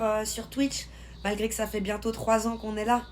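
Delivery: ordinary talk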